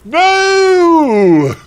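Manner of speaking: hammed-up terrified scream, very high and loud; long melodramatic wail of horror; scared shout. Heroine confronted by Voldemort, hammed-up scared shout. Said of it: "I shouted in a scared voice".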